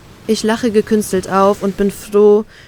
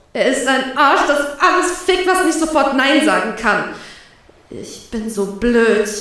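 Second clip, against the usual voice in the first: hurt outrage; 4 semitones higher than usual. Acted. Inner outburst continues.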